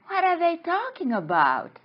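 high-pitched puzzled question; acted bafflement. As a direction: wonder it aloud in mock alarm. Acted bafflement at fish's warning.

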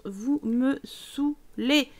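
« Vous me saoulez » très lentement étiré; traîne les mots, lasse; agacement traîné. Pretend. Repeats slowly. (lassitude étirée)

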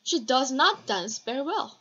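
not leaned on, slowish; stress on not. Shown as a demonstration. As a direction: model sentence, stress not. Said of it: "왜 이땐 does가 붙어요".

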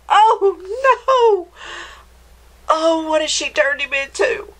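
high-pitched storybook dismay; dismayed shock. Acted. Reader seeing what the witch did, shocked dismay. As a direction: exclaim as if appalled at the change.

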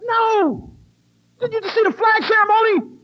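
high, loud incredulous outburst; exclaimed sarcasm. Acted. Answering people asking if she was nervous, incredulous. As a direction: fire back in mock outrage.

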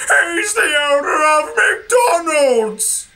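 high crying shout. Acted. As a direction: blurt it out in a high yell. Percy blurts out answer.

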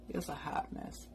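whispered aside; whispering. Real, not acted. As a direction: whisper it under your breath. Repeats it as an aside.

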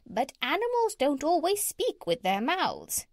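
high, squawky parrot voice. A narrator's reading. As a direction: lift it right up into a parrot's pitch. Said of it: "in a high voice".

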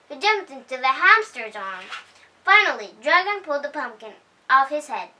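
plain reading voice

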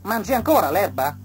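high, loud grasshopper voice; grasshopper's voice. A narrator's reading. Grasshopper asking the butterfly, high.

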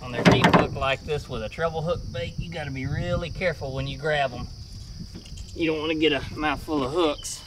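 normal talking voice

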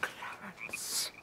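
a cold, breathy whispered name; icy whisper. Acted. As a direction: hiss his name in contempt. Wife storms off in disgust.

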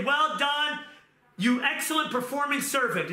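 mock praise. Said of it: "he's gonna say".

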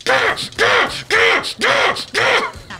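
high squawking cackle; clucking laugh. Real, not acted. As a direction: cackle like a chicken. Chicken-like cackle.

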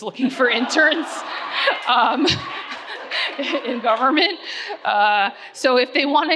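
real laughter breaking into the words; laughing. Real, not acted. Speaker pitching internships to parents in the audience, laughing. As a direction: laugh through an offhand plug.

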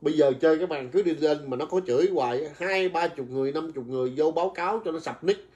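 usual speaking voice

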